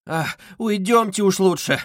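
high, drawn-out plea; flustered mother's plea; scared. A narrator's reading. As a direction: anxiously urge them to leave. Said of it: "перепугалась мать".

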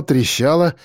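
ordinary delivery

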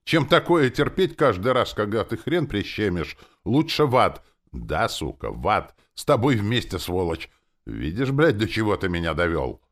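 loud, high-pitched angry outburst; furious rant. A narrator's reading. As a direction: lash out at him, cursing loudly. Demon rants furiously at the narrator.

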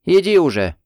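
loud, impatient push; angry. A narrator's reading. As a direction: snap it, annoyed. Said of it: "сердито толкнула".

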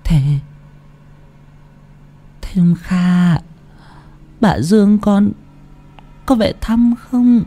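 faint broken phrases climbing into a high, thin wail; dying grandmother's halting voice, long gaps between words; feeble, gasping old woman. A narrator's reading. Sick grandmother asking where her children went, feebly gasping. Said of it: "đôi môi khô nứt nẻ thều thào".

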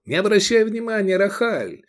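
high, hurried appeal; urgent pleading. A narrator's reading. Envoy begs the sheriff to spare his friend, pleading. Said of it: "закричал Мирарзал".

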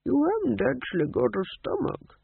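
put-on high old man's voice; old ghost king. A narrator's reading. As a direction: point it out mildly as an old ghost.

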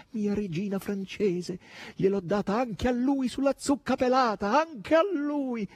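high, drawn-out gleeful clamour; noisy squawking. A narrator's reading. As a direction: crow it loudly, stretching the ends. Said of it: "ridestando con i suoi schiamazzi". (schiamazzi acuti e prolungati)

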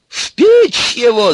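very high, loud, drawn-out scream; furious yell. A narrator's reading. Baba Yaga orders Ivan into the oven, yelling. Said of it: "заорал Яга и затопал ногами".

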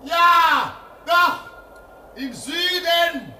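thrilled cry from the lighthouse; excited sighting. Acted. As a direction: spot it and shout with joy. First sight of the plane.

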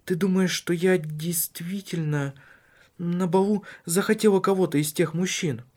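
a worried wife pressing her husband; Cecilia's voice. Acted.

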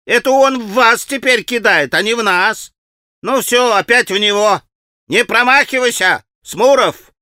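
high, urgent schoolboy cries; excited boys' shouting. A narrator's reading. Boys urging Smurov not to miss, excited shouting. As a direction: egg on your friend in a boyish holler.

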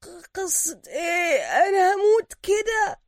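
high, strained choking cry, dragged out; hoarse, choked voice. A narrator's reading. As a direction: plead slowly as if being strangled. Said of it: "يرد سوكا بصوت مبحوح".

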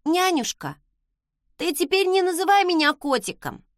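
put-on high child voice, very serious; grave plea in a high little voice; earnest little boy. A narrator's reading. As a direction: make a solemn request as a small boy. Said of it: "очень серьёзно попросил Родерик".

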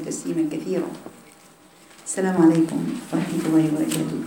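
measured, solemn recitation of the opening praise; formal opening invocation. Acted. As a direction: recite the khutbah opening calmly and evenly.

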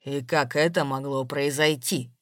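low, controlled question; completely calm. A narrator's reading. Man suddenly questions the robbed woman, completely calm. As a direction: drop low and ask calmly. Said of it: "вдруг совершенно спокойно спросил он".

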